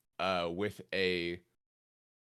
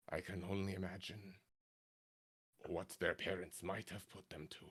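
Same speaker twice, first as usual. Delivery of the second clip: quiet butcher; 11 dB quieter than usual. Acted.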